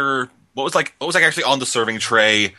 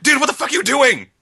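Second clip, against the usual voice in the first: shout; 10 semitones higher, 3 dB louder than usual. Retold or imitated. Peter yelling at Doc Ock.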